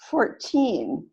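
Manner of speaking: put-on "fourteen" with TEEN long; stress on second syllable. Shown as a demonstration. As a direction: model "fourteen" as four-TEEN. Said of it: "is the opposite from forty".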